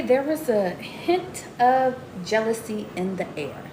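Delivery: ordinary talk, nothing marked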